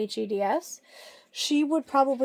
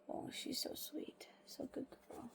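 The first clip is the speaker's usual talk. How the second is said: whisper; 3 semitones higher, 22 dB quieter than usual. Real, not acted. Owner whispering praise to her dog. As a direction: whisper it gently.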